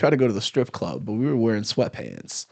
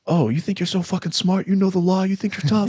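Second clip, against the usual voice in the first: high-pitched taunting cop, no real volume; mock yell, pitched well up; retold yelling cop; 9 semitones higher than usual. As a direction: imitate a cop berating someone. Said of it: "he's just yelling at me".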